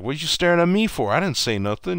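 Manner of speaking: high-pitched, indignant mock protest; defensive protest. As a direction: imitate someone protesting his innocence. Retold player protesting a stare.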